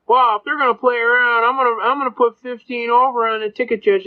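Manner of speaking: high-pitched mock complaint; imitated protesting cop. Cop objecting to the judge, high mock protest. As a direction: push the voice up high, as an indignant cop.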